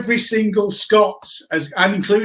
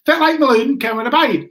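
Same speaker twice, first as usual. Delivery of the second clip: mock Aberdeen accent repeated, a bit louder and higher; repeating the Aberdeen accent; 7 semitones higher, 3 dB louder than usual. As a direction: say the local's line again in a broad Aberdeen accent, pushing it.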